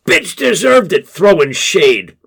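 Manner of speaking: high, loud heckling yell. A narrator's reading. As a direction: shout it out from the crowd. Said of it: "another voice yells".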